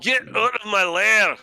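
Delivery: loud, high Scottish roar; Scottish accent. Pretend. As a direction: bellow it like an ogre in a Scottish accent. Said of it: "trying to do a Scottish accent".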